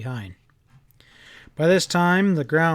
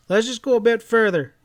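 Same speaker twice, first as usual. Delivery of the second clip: put-on pleading boy, louder and higher; begging; 8 semitones higher, 4 dB louder than usual. A narrator's reading. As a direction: plead with the men not to give up. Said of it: "I begged".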